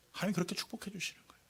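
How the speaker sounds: fast hushed whisper; whispered to the congregation. Real, not acted. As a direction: confide it under the breath.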